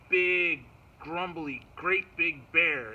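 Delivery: "big" hit loud, "bear" stretched out slow; drawn-out emphasis. A narrator's reading. Storyteller introducing the bear, drawn-out emphasis. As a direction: punch "big" and draw out "great big bear".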